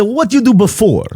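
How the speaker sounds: high, imitated Greek questioner; Greek accent. Father-in-law asks about earlier work, Greek accent. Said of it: "my best Greek impression".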